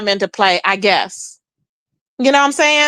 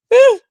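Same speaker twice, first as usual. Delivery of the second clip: whew; 10 semitones higher, 5 dB louder than usual. Real, not acted. Reacting to the fight.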